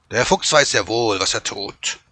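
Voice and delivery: loud, stern wolf voice; gruff wolf shutting down complaints; growling wolf. A narrator's reading. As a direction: snap it with a growl. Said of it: "knurrte er".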